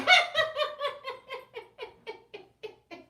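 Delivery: high falling laughter; long laugh. Real, not acted. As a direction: laugh till breathless. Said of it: "Y'all got me cracking up here".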